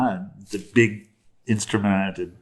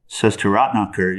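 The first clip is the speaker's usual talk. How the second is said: usual speaking voice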